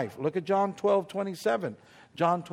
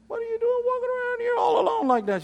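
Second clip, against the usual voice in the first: high calling voice; 6 dB louder, faster than usual. Retold or imitated. Calling out to Miss Ruthie.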